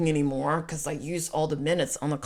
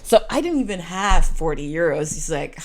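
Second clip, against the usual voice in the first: normal talking voice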